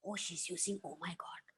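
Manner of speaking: imitated scandalized whisper; hushed mock-shocked gossip, all breath. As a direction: whisper it like a disapproving onlooker. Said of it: "I've had people come and said".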